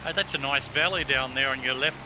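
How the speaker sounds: normal talking voice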